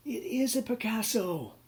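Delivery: triumphant discovery. Retold or imitated. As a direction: gloat over the find. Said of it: "and he goes".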